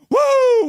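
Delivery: short, loud, very high woo; victory whoop. Real, not acted. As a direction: whoop with full force. Celebrates the title win.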